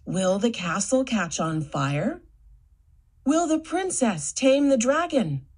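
ordinary delivery, nothing marked

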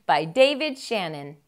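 plain reading voice

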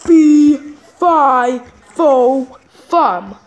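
low, loud booming giant chant; giant's fee-fi-fo-fum. Acted. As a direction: stomp in like a fairy-tale giant. Dad arrives as giant chant.

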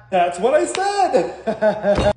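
very slow, drawn-out mom voice with a laugh; slow, laughing imitation of a mother's know-it-all reply; talking like Mom. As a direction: stretch every word the way mom does. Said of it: "Why are you talking like that? ... Like Mom".

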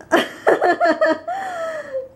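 high burst of laughter. Real, not acted. Laughing at rude TV lady.

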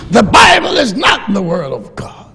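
high, loud, forceful teacher's pronouncement; retold declaration. Seminary teacher declaring to his class, loud and forceful. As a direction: slam the statement down like a lecturer.

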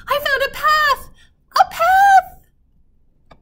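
very high, very loud yell of triumph; excited yell. Acted. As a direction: call it back across the cave, thrilled. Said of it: "she yelled again".

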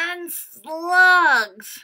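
very loud, high yuck; mock disgust. Teacher playfully grossed out by slugs while reading. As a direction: gross the kids out.

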